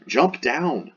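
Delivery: a restrained call to jump; acted shouted order. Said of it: "I would say he shouted".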